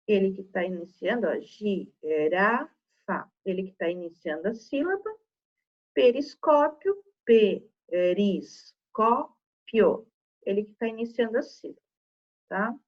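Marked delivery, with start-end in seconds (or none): 1.4-3.3 "girafa" dita gi-ra-fa, devagar e mais grave; separando sílabas. Shown as a demonstration. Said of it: "Se eu separar sílabas". 7.9-10.1 "periscópio" dito pe-ris-có-pio, bem devagar; separando sílabas. Shown as a demonstration. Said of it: "Se eu separar sílabas".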